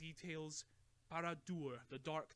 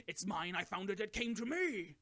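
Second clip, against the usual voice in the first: loud, higher, grasping; possessive outburst; 5 semitones higher, 9 dB louder than usual. A narrator's reading. Bilbo lashing out at Gandalf over the ring, angry. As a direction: snap it out, clutching the ring.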